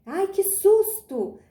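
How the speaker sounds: high, loud, drawn-out startled exclamation; exclamação de susto. Shown as a demonstration. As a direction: act out a fright as an example. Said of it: "ele vai indicar admiração, alegria, surpresa, espanto". (susto encenado, agudo e alongado)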